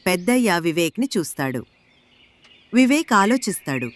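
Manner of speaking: usual speaking voice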